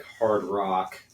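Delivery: ordinary talk, nothing marked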